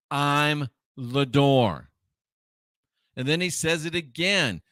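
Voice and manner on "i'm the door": very slow, words set apart; deliberate, spaced-out scripture quote; solemn declaration. Retold or imitated. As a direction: pause after the first word and draw out the rest. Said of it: "Jesus says right here again".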